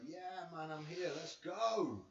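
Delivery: high, hyped-up fighter voice, slowing at the end; cocky fighter swagger. Retold or imitated. Vlogger voicing Haney's ring-walk swagger, cocky and high. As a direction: strut it like a cocky boxer. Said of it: "His whole attitude is like".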